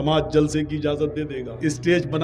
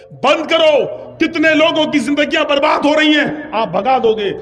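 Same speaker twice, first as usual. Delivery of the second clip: a raised, higher cry to shut it down; preacher's protest; 10 semitones higher, 7 dB louder than usual. Acted. Maulana at the liquor shop, shouting to close it. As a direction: call out like a cleric scolding a shopkeeper.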